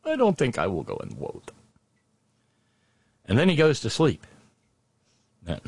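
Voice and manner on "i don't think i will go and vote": W for V, a heavy mock Russian accent; comic Russian accent on vote. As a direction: repeat it in the thick Russian voice. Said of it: "I like the W sound".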